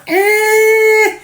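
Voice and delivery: high squeaky eh of disbelief; reliving the visit, a high startled eh; shocked squeak. Retold or imitated. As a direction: squeak it out, stunned. Shocked at rock slid across.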